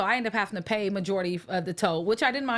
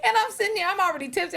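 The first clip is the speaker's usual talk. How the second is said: laughing; 9 semitones higher than usual. Real, not acted. Laughing as she tells.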